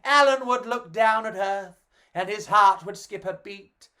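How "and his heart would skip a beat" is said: ordinary delivery, nothing marked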